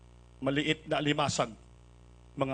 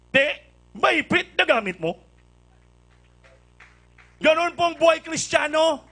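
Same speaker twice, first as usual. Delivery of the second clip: preaching at full force; 6 semitones higher, 6 dB louder than usual. Acted.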